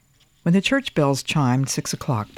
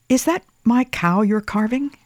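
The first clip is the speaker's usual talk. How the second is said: put-on child voice; 9 semitones higher, 4 dB louder than usual. A narrator's reading. Boy voiced in story.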